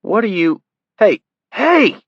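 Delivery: high, loud startled protest; alarmed cry. Acted. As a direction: yelp as the flame comes up. Kid alarmed as Greg lights flame.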